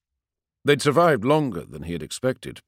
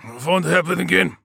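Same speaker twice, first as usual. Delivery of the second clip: determined soldier; 9 semitones higher, 6 dB louder than usual. Acted. Wounded soldier vows to captain.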